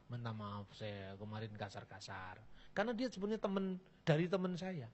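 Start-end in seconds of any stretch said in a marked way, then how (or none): 0.1-2.4 much softer and lower apology. Retold or imitated. Preacher apologizing to the Witness for his harsh words, softly. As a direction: say sorry gently, almost under breath. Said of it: "Setelah itu saya minta maaf".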